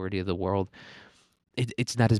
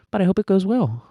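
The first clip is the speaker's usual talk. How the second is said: much higher, louder sing-song; cheery wish; 10 semitones higher, 6 dB louder than usual. Real, not acted. Wishing listeners a good summer, much higher and louder. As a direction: lift it bright and high.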